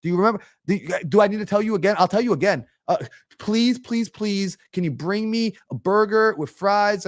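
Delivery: high, fast, pestering panic; freaking out. Acted. Diner anxiously chasing the waitress about an order, freaking out. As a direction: play an anxious diner, pleading urgently. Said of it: "you're not freaking out saying".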